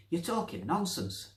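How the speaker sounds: put-on high old woman's voice, rising sharply on nonsense; Nancy scolding. Nancy arguing with the unseen voice. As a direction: tell him off like an old lady. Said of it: "Nancy was saying".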